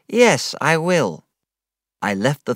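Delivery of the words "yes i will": put-on slow, sheepish little-boy answer; obey like a scolded child; meek small boy. A narrator's reading. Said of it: "feeling like a small boy".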